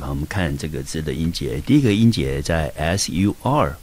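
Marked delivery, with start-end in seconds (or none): none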